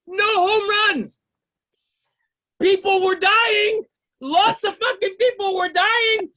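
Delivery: stand-up tirade, loudly aggrieved; indignant rant. Acted. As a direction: shout your indignation at the players. Indignant rant about the game.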